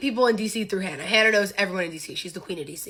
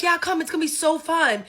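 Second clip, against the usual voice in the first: high-pitched retold enthusiasm; imitated party-hype voice; mock eager invitation; 6 semitones higher than usual. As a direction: coax a friend along, pitched up. Group eagerly urging friend to come.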